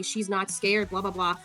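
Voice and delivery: ordinary talk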